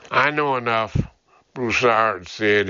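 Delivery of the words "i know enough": plain reading voice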